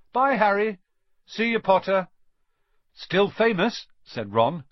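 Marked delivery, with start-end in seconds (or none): 0.1-2.1 higher, louder goodbye call. A narrator's reading. Students call goodbye to Harry, higher and louder. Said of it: "Some of them called". 2.9-3.9 higher, louder teasing; teasing grin. A narrator's reading. Ron teases Harry about fame, grinning. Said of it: "grinning at him".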